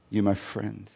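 тихий нежный вопрос; soft tender question. Acted. Jesus asks tenderly.

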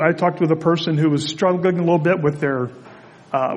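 usual speaking voice

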